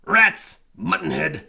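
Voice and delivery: loud cross exclamation; annoyed outburst. A narrator's reading. Wolf forgot his paw prints, loud cross outburst. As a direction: snap at yourself in annoyance.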